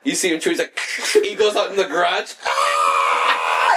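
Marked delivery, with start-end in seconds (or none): 2.4-3.7 low, drawn-out comic scream; imitated scream. Man chewed ice with bad tooth, imitated scream. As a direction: holler it like a sitcom dad in pain. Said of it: "He screams".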